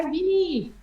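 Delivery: usual speaking voice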